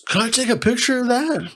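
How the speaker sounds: high, eager voice of a fan; hammed-up excited car fan; excited stranger. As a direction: ask like a kid begging for a photo.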